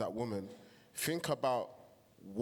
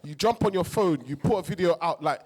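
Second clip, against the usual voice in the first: louder, heated and driven; real passion; 3 semitones higher, 7 dB louder than usual. Real, not acted. Host apologizing to viewers, then louder heated passion. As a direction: pour real feeling into the point, getting louder. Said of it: "sorry, guys, I'm passionate".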